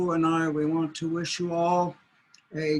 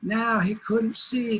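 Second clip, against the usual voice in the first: ordinary delivery, nothing marked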